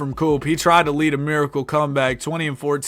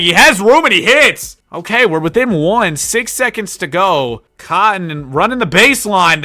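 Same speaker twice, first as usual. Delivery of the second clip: higher and louder commentator call; excited call; 4 semitones higher, 7 dB louder than usual. Acted. Streamer narrates the comeback three, excited louder call. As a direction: lift it as the lead shrinks.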